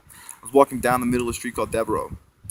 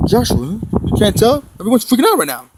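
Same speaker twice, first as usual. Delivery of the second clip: urgent call; 9 semitones higher, 7 dB louder than usual. Retold or imitated. Voice calling out urgently.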